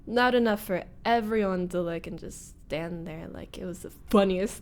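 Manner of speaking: ordinary delivery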